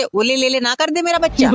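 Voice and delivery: mocking baby talk.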